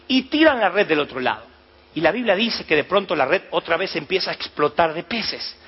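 usual speaking voice

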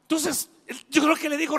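ordinary delivery

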